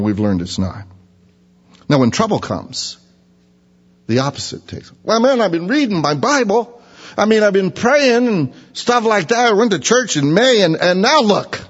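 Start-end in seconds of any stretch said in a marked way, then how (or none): 5.0-11.6 much higher, indignant whiny protest; mock complaint. Believer griping at God in trouble, mock whine. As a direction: complain that God let you down.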